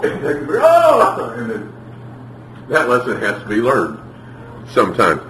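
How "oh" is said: high, loud "oh" outburst; mock cry of alarm. Preacher reenacting the moment after the boy disobeyed, mock outcry. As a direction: burst out with a high, acted cry. Acts out outburst in retold story.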